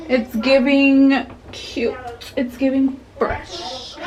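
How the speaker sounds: drawn-out sassy catchphrase; slow sassy drawl. Real, not acted. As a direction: stretch it out, posing.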